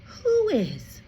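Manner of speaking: usual speaking voice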